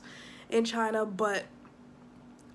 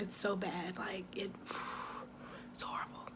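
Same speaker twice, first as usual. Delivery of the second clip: hushed whisper of disgust; 3 semitones lower, 14 dB quieter than usual. Real, not acted. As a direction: drop to a whisper, repulsed. Disgust at the smelly alleyway.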